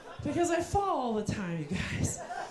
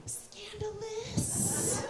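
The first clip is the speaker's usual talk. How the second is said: high, hushed, drawn-out aside; soft high-pitched fake shock; mock scandalized; 8 semitones higher, 8 dB quieter, much slower than usual. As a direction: stretch it out like juicy gossip. Mock gossip aside about parents.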